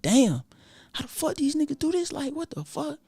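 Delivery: high, hushed disbelief; staring at exotic cars, high and hushed; hushed amazement. Retold or imitated. Awed by rich people's cars.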